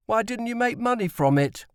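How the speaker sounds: put-on high, curious boy asking; boy's voice. A narrator's reading. Tim asks his dad at supper, high and eager. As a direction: voice young Tim lightly, eager.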